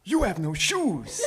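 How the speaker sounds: high, put-on old man voice; excited old character voice; old shoemaker, excited. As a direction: exclaim it as an eccentric shopkeeper.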